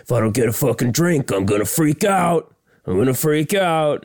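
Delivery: high-pitched imitation of an irate gambler; hammed-up gambler losing it; mock freak-out. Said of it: "so he's freaking out".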